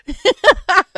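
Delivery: high, loud laugh; real, loud cackle; burst of laughter. Real, not acted. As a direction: burst out laughing at your own remark. Laughing at own remark.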